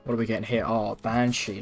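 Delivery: ordinary talk, nothing marked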